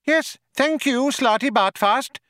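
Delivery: high sharp mouse voice; curt tiny mouse dismissing him. Acted. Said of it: "said Benji Mouse sharply".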